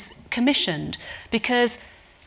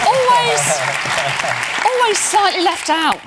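pitched high and louder, "always" stretched in playful pity; mock sympathetic tease; 10 semitones higher, 6 dB louder than usual. Host teasing the actor left out, high mock pity. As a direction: tease him, lean hard on "always". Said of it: "teasing Dylan as left out".

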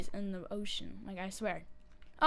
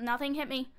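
usual speaking voice

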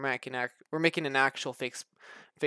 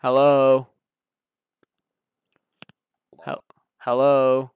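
calling out; 12 dB louder, slower than usual. Real, not acted. Calling out to an unresponsive friend.

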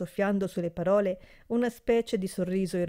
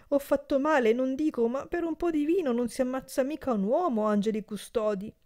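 high pleading; 7 semitones higher than usual. A narrator's reading. Servant pleads his case.

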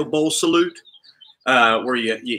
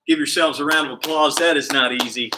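ordinary delivery

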